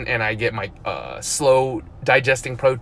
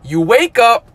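normal talking voice

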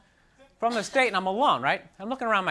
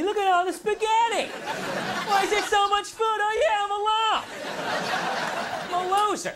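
mock self-pity; 11 semitones higher than usual. Comic mocks himself alone with four chairs, high whiny voice. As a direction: whine much higher, like a sad sack noticing the empty chairs.

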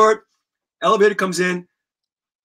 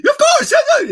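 much higher, louder eager squeak; high kid voice; 5 dB louder than usual. Retold or imitated. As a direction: jump in eagerly like an excited kid. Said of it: "Tommy had this high voice".

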